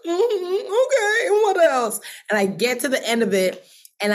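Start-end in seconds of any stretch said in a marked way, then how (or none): 0.1-2.0 very high mock-weepy squeak; tearful, crying. Woman writing down God's plans while crying, mock tearful. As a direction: imitate crying through dictation, pitch way up. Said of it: "I'm, like, writing and crying".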